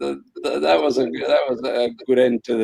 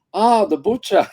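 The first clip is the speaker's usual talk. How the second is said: higher-pitched "oh, it's you" exclamation; retold pleased realisation; imitated recognition; 8 semitones higher than usual. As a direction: act out suddenly placing someone. Acting out delighted recognition of name.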